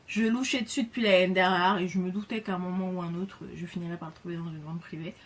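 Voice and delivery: ordinary delivery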